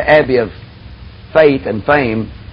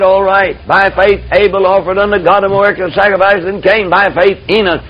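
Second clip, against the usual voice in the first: raised preaching read of Hebrews; scripture reading; 4 semitones higher than usual. Acted. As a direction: proclaim the verses higher.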